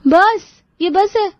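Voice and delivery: high, slow, stunned repeat of बस; shocked disbelief. Acted. Sister-in-law stunned at the sweets list, slow high disbelief. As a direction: stretch it out in disbelief.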